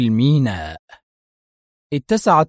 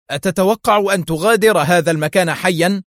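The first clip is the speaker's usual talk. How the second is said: shout; 6 semitones higher, 3 dB louder than usual. A narrator's reading. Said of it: "وهو يهتف".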